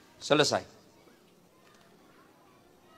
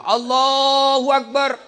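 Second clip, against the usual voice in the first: imam's takbir; 9 semitones higher, 6 dB louder, much slower than usual. Retold or imitated.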